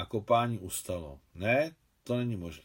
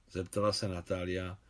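plain reading voice

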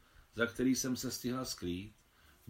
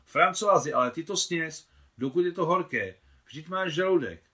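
higher, louder fussing plea; urging; 5 semitones higher, 7 dB louder than usual. Acted. Claudine fusses at François to eat while it's hot, loud.

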